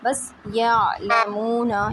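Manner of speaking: slow यालमूना with the या kept steady; teacher models यालमूना slowly; slow and even. Shown as a demonstration. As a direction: hold यालमूना slowly with no bounce. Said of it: "तो या नहीं करना".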